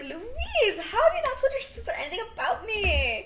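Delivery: very high-pitched imitation of a put-out girlfriend; mock indignant question. Girlfriend confronts Luis about his sister, in a high mock protest. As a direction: act out her protest way up high.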